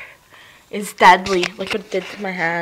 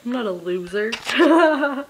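ordinary talk, nothing marked

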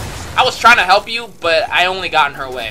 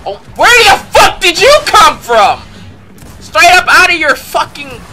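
startled shout; 9 semitones higher, 8 dB louder than usual. Real, not acted. Ambushed in game.

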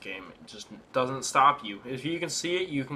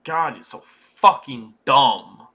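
exasperated insult; 10 dB louder, slower than usual. Real, not acted. Insulting the horse.

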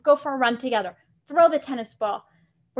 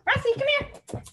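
much higher, laughing call; high call to dog; 12 semitones higher than usual. Real, not acted. Trainer calling her excited dog, high and laughing.

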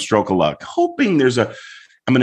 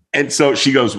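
normal talking voice

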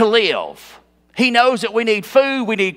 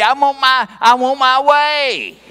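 mock a spoiled tantrum; demanding his way; 5 semitones higher, 3 dB louder than usual. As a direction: insist like a sulking child, dragging out the last word. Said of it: "he wants to have his way".

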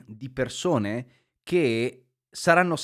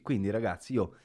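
ordinary delivery

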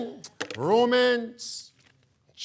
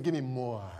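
mock sleepy congregant; 10 semitones lower, 9 dB quieter than usual. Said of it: "Are you saying".

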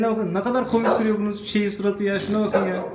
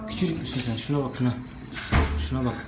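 softer, lower cooing at the pup; 5 semitones lower, 5 dB quieter than usual. Real, not acted. Owner coos softly over the tiny puppy. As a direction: murmur it tenderly to the little one.

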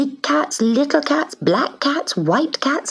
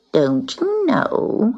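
slow, high scornful drawl; sneering; 7 semitones higher, much slower than usual. Acted. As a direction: draw it out with a sneer. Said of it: "she sneered".

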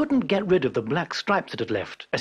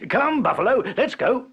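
higher, louder call to the buffalo; 9 semitones higher, 5 dB louder than usual. Acted. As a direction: cheerfully call the buffalo away. Peasant calls buffalo.

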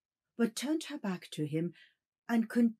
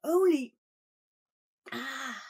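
high breathless gasp; panting; 6 semitones higher, slower than usual. Acted. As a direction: break off gasping. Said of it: "panted the other".

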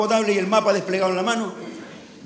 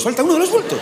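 high, louder fretting tourist; mock panic over a missing bag; anxious tourist; 11 semitones higher, 5 dB louder than usual. Comedian mimics a tourist panicking over his bags. Mocking a tourist at baggage claim.

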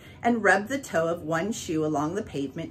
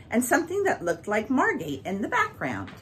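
plain reading voice

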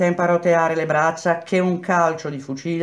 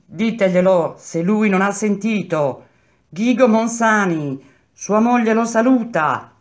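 woman shouting; 5 semitones higher, 4 dB louder than usual. A narrator's reading. Wife to prisoners, passing on a greeting, shouting. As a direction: call a greeting through the bars. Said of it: "gridò la stessa voce".

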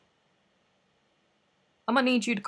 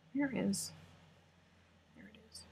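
whispering; 12 dB quieter, faster than usual. Real, not acted.

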